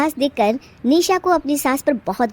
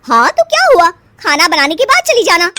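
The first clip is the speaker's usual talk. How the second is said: louder, higher, dismissive; a sister-in-law's loud, higher, dismissive order; bossy sister-in-law; 6 semitones higher, 7 dB louder than usual. Acted. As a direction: brush her off loudly.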